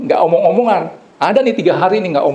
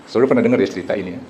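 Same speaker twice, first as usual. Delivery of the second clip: normal talking voice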